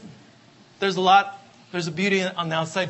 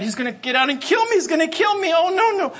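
rising higher into fearful panic; panicked thought; 9 semitones higher, 3 dB louder than usual. Retold or imitated. Woman on the highway, panicking as the trucker approaches. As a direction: voice her terror as he closes in. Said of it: "And she thought".

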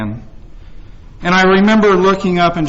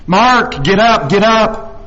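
imitated sideline yell, higher pitch; 5 semitones higher, 4 dB louder, slower than usual. Mother to her son from the sideline, yelling. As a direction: call out like a cheering sports parent. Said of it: "running along the sideline yelling".

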